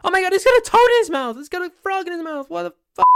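very high, loud, rapid shriek; imitated screaming. Husband imitating wife finding a frog in dog's mouth, screaming. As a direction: scream it out in horror, sky-high and fast. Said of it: "she starts screaming".

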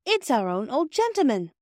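put-on a girl recognizing a friend with joy; delighted surprise. A narrator's reading. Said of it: "said Phyllis".

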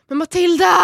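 high, loud scolding yell of her name; mock angry shout. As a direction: play the irritated partner scolding. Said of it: "istället för att bara så här".

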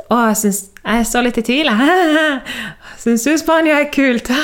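high, hammed-up panicky voice; mock-anxious high-pitched confession; nervous confession. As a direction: squeak out an awkward admission, half laughing. Acting out confessing her doubt to husband.

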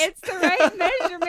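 high, loud self-justification; hammed-up indignant excuse; mock defensive protest. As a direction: protest playfully as if caught out. Acts out own defensive reply.

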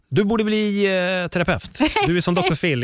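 mock-gushing friend calling back; excited friend. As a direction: pitch it way up like an impressed buddy. Friend calls back, teasing praise.

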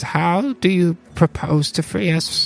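a small gnome asking carefully. Acted. GM voices gnome.

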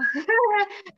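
a high, loud burst of laughter. Real, not acted. Bragging about ranking high, a loud high laugh. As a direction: crack up at your own boast.

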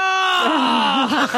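a sudden yelp of surprise; startled cry. Real, not acted. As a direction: jump at the shock. Reacting to the vampire grab.

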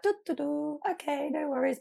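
high, airy, unbothered hum; carefree sing-song. Retold or imitated. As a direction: breeze along, pitched high. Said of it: "I just was like".

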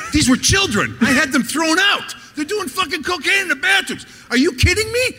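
high, rising indignant outburst building to a shout; outraged protest. Retold or imitated. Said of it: "I go".

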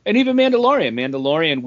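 ordinary talk, nothing marked

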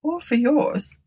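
eerie high-pitched reply; odd, involuntary utterance. A narrator's reading. Martha answers Tabitha despite herself, in odd fashion. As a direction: say it as if despite yourself. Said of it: "coming from her in some odd fashion".

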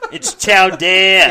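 "chow dare" said slowly, loud and high, Boston style; teaching the Boston way to say chowder. Shown as a demonstration. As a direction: stretch out "chow dare" in a thick Boston accent. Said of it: "Say it".

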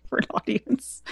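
breathy whispered afterthought; whispered aside. Real, not acted. Guest admitting first recitation for listeners, whispering. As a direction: drop to a shy whisper.

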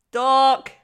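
loud teasing "dork, no"; mock shout. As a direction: shout it down, half-laughing. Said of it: "And I was like".

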